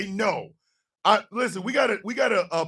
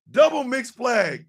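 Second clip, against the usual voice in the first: referee call; 5 semitones higher than usual. Acted.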